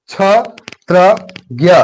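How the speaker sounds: छत्रज्ञ spoken very slowly, every cluster held; slow drawn-out term. Shown as a demonstration. Teacher stretching छत्रज्ञ slowly for students. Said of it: "याद रखिए कि छत्रज्ञ".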